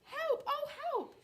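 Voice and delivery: high squeaky cries for help; high-pitched alarm; frightened chick. Acted. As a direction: call out in little-chick panic.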